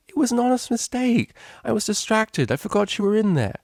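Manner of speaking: high, fast excuses; flustered apology. Acted. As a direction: babble out the excuse.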